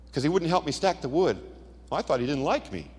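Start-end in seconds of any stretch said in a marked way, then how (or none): none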